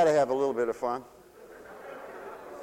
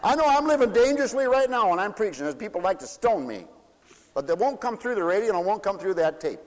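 raised, loud preaching voice; full-force preaching; 10 semitones higher, 3 dB louder than usual. Acted. Preacher daring the congregation to come with stones, loud and raised. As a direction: preach it out to the room.

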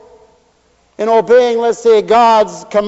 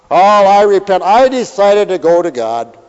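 smug mock repentance; 7 dB louder than usual.

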